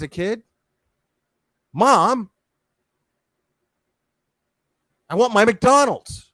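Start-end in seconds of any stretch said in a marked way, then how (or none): 1.7-6.3 put-on high, loud bratty child; spoiled brat yelling for food; whiny demanding kid.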